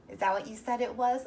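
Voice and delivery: usual speaking voice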